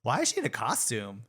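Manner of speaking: mock-baffled questioning, rising into laughter; incredulous quote. Quoting Drac's reaction to a costume, mock bewilderment. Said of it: "she's like".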